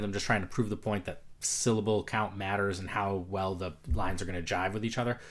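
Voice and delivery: normal talking voice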